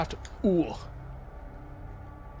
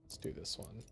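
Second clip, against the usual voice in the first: whisper; 5 semitones lower, 18 dB quieter than usual. Real, not acted. Trails into whisper.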